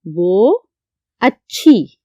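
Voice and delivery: dictating मेरी भावज; dictation pace. Shown as a demonstration. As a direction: spell it out slowly for the class to write. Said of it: "दुरुस्त करके लिखते हैं".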